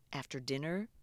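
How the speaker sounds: ordinary delivery, nothing marked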